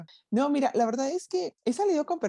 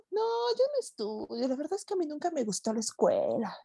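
high, airy mock voice of a slacker date; imitating the date; 4 semitones higher than usual. Mock date shrugging off school, high and lazy. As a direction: mimic the careless guy from the blind date, pitched high. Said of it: "¿Y tú?".

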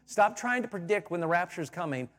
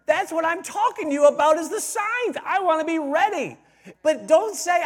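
louder and higher, preaching at full force; fiery preaching; 10 semitones higher, 7 dB louder than usual. Acted. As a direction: lift it up and drive the point home to the church. Sermon climax.

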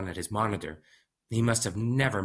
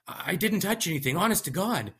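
pleading; 10 semitones higher than usual. Acted. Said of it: "I pleaded".

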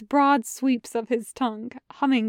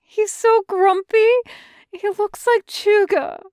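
gushing over a puppy; 9 semitones higher, 6 dB louder than usual. A narrator's reading. Chung Chung gushing over a grumpy puppy, high and cooing. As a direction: coo it out in a much higher voice. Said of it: "Chung Chung cries".

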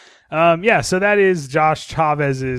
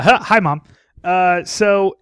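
ordinary talk

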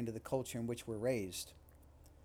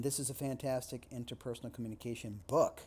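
book lifted with a rise at the end; stress on BOOK. Shown as a demonstration. Lecturer demoing shifted emphasis, book stressed. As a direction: land the weight on book. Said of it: "just by shifting the emphasis".

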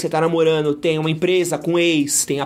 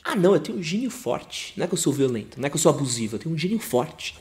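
softer, lower mumbled excuse; mock excuse; 5 semitones lower, 7 dB quieter than usual. Mocking an abusive partner's excuse, softer and lower. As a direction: imitate a partner playing down violence.

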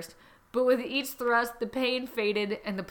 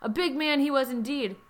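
ordinary talk, nothing marked

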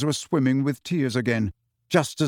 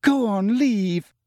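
tearful, higher go on, leave; crying; 8 semitones higher, much slower than usual. A narrator's reading. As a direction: send him away through tears. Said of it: "now crying into the napkin".

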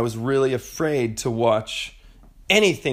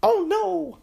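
mock alarm.

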